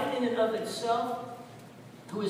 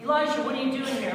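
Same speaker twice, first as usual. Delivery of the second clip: higher, quick questioning call; challenging question; 4 semitones higher, 4 dB louder, faster than usual. Acted. God to Elijah after the displays, challenging question.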